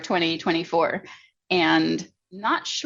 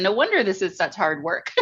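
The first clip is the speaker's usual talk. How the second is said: ordinary talk, nothing marked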